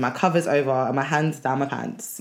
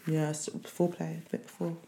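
quiet; 13 dB quieter than usual. Real, not acted. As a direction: say it almost under your breath. Intimate confession, very soft.

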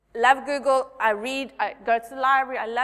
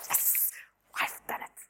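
1 whispered triumph; 10 dB quieter than usual. Retold or imitated. Quiet triumph before dinner.

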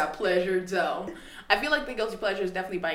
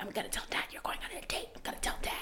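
whispering; 13 dB quieter, faster than usual. Retold or imitated. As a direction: hiss the threat under your breath. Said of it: "He's like".

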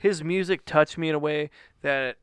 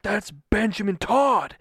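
louder, higher, slow and emphatic; starstruck insistence; 6 semitones higher, 5 dB louder, slower than usual. Retold or imitated. Husband repeats sighting to disbelieving wife, excited insistence. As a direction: drive each word home in excited disbelief. Repeats it after wife's "What?.

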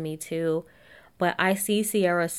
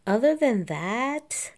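sing-song; 6 semitones higher, 3 dB louder, slower than usual. Real, not acted. Drawn-out transition.

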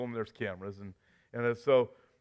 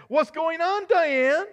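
high, shaky, shouted question; quivering anchor voice; 11 semitones higher, 4 dB louder, slower than usual. Pretend. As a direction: anchor voice breaking with fear. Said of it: "The anchor man's voice quivers".

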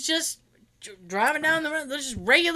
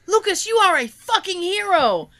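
gushing praise; 7 semitones higher, 4 dB louder than usual. Retold or imitated. Gushing praise quoted in story.

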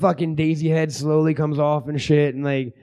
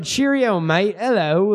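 higher mocking copy of British attempt; imitating bad British; 4 semitones higher, slower than usual. Host mocks the guest's accent attempt, exaggerated mock British. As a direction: parody a friend's botched British accent. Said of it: "you said".

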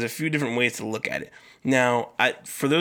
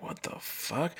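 very quiet, breathy, stunned mutter; hushed disbelief; 3 semitones higher, 14 dB quieter, slower than usual. Retold or imitated. Telling listeners his reaction to the police visit, hushed disbelief. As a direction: say it under your breath in disbelief.

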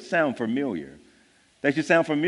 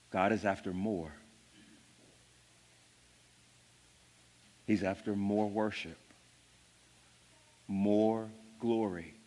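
hushed and intimate; 6 semitones lower, 7 dB quieter than usual. Real, not acted. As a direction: draw them in with a near-whisper tone. Quiet reflective close of point.

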